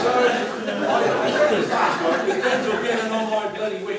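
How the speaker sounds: loud, laughing through the words; chuckling loudly while starting the tale. Real, not acted. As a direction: laugh as you launch in.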